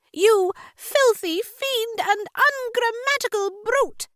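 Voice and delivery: very high-pitched indignant heroine; crying out. A narrator's reading. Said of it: "she cried".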